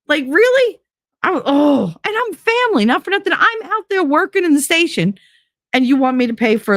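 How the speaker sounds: high, indignant disbelief; outraged. Real, not acted. Venting about being charged by family, outraged. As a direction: rant in disbelief.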